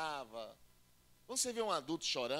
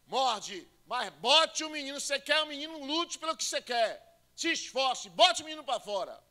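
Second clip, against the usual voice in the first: loud, much higher, pressing command; midwife yelling; 8 semitones higher, 11 dB louder than usual. Retold or imitated. Midwife yelling at a woman in labour to bite and push. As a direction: drive her to push with a shout.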